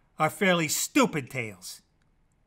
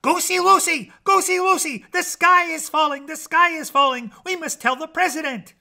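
high, loud frantic chicken; shrill-pitched barnyard panic; yelling hen; 11 semitones higher, 8 dB louder than usual. A narrator's reading. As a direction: yell the alarm like a panicked hen. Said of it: "and yelled".

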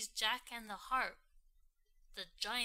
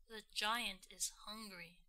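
ordinary delivery, nothing marked

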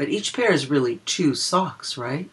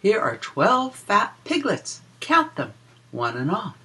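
put-on lilting read-aloud for small children; a sing-song rhyme for little listeners; reading a picture book rhyme; 6 semitones higher than usual. Reads rhyming counting book verse.

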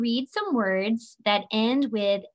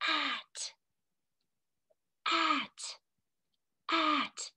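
hushed, soft stage whisper; 5 semitones higher, 8 dB quieter, much slower than usual. Shown as a demonstration. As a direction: whisper it to the children. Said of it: "let's say it in a whisper".

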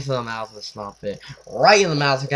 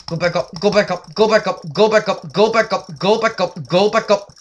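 urgent pleading; 5 semitones higher, 6 dB louder than usual. Real, not acted. Urging the fish.

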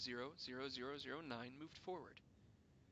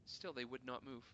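plain reading voice

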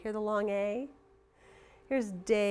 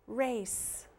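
ordinary delivery

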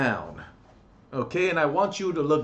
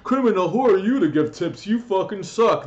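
a whiny jeering troll, pitched higher and louder; mocking troll; 4 semitones higher, 5 dB louder than usual. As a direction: sneer it like a hater in the comments. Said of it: "trolls out there are like".

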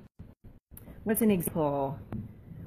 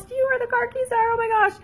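much higher and fast, panicky; frantic search; 5 dB louder, faster than usual. Retold or imitated. Parent hunting lost car keys, asking the house in a panic. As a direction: blurt it out in a rush of panic. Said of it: "we ask everybody in the house".

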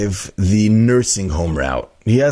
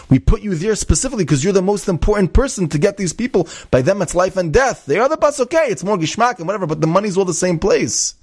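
much higher, sputtering tirade; ranting boss; 9 semitones higher than usual. Retold or imitated. Egged CEO berating the driver for ruining the company, high angry rant. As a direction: scold him like a boss losing it.

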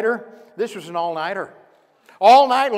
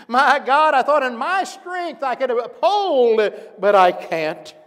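anguished cry; 8 semitones higher, 9 dB louder, slower than usual. Acted. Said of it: "even Peter realized that night".

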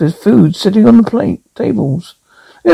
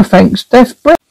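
usual speaking voice

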